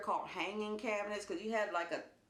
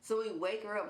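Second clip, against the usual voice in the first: ordinary delivery, nothing marked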